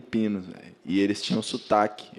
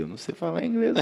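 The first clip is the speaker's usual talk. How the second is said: lightly raised, mock-helpless quote; retold own words; 9 semitones higher, faster than usual. To the old Filipino host, mock-helpless pitch raised. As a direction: replay your panicked self, a bit higher. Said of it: "eu falei".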